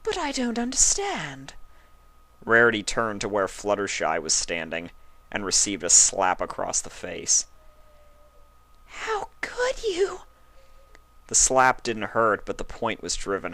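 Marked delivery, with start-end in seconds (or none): 0.0-1.5 high, confused lady voice; lost and puzzled; baffled Rarity. A narrator's reading. Rarity not understanding, high. 8.8-10.2 very high, slow hurt cry; tearful reproach. A narrator's reading. Fluttershy after slapping Rarity, high and hurt.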